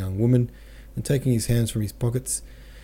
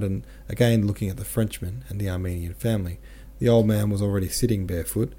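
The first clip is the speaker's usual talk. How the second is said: plain reading voice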